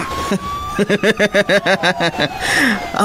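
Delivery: gloating laugh. Acted. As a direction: laugh like the plan worked. Gloating over the escape. (nagballigi a katawa)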